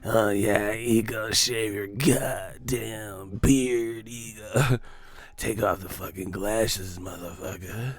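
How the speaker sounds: slow, drawn-out creep voice; mock lustful stalker; creepy male fan. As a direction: drag it out like a leering fan. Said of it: "These motherfuckers be stalking me".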